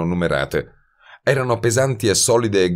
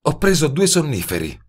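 higher female voice, worried; Betty's voice; 9 semitones higher than usual. A narrator's reading. (voce femminile più acuta, preoccupata)

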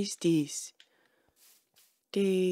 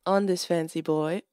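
normal talking voice

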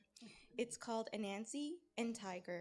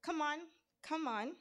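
higher, urging call; storybook shout; 7 semitones higher, 6 dB louder than usual. A narrator's reading. Anansi cheers the climbing Tiger on, storybook shout. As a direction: egg him on in a raised voice. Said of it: "Anansi shouted".

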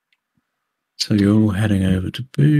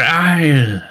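louder, higher call across the room; young mech tech hailing the boss; 7 semitones higher, 4 dB louder than usual. Acted. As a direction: shout his name as you walk in.

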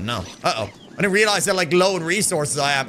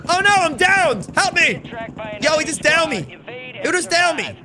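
panicked; 10 semitones higher than usual. Real, not acted. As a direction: yelp for help as you go down. Getting downed in game.

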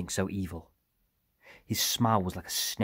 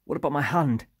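higher, louder wounded complaint; self-pitying; 8 semitones higher, 5 dB louder than usual. A narrator's reading. Wounded Iram asks about his own injury, self-pitying. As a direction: plead for sympathy with raised pitch. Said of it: "feeling sorry for himself".

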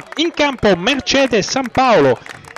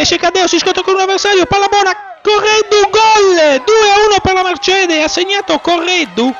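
excited goal shout; 9 semitones higher, 3 dB louder than usual. Real, not acted. Winning goal, live commentary.

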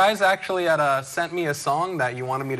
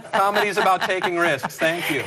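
normal talking voice

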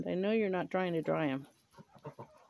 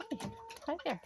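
ordinary talk

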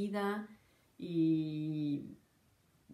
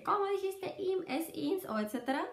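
much higher, nitpicking student voice; mock know-it-all; 12 semitones higher than usual. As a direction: mimic a smug grammar stickler, high and pointed. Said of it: "me dice". (burla aguda de sabelotodo)

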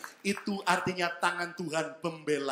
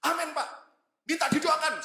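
eager church member; 11 semitones higher, 5 dB louder than usual. Retold or imitated. Congregant asks for prayer.

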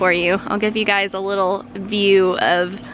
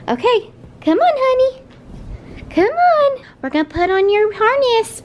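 high, sing-song puppy talk; dog-talk voice; 12 semitones higher than usual. Real, not acted. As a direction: coo at the dog excitedly. Coaxing dog into harness.

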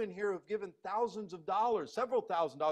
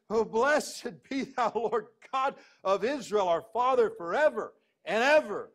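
loud, ringing praise with slowed peaks; joyful proclamation; 7 dB louder than usual. Shown as a demonstration. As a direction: proclaim it in overflowing joy. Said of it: "you can almost see him saying".

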